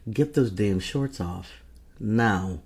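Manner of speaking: loud, firm order; bossy command. Acted. Ordering a hesitant partner to hurry, bossy command. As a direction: push each order out with force.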